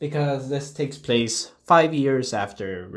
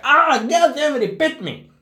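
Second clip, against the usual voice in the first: high loud yelp, then cursing; mock pained yelp; 13 semitones higher, 5 dB louder than usual. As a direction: yelp like you just got bitten. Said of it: "He's like".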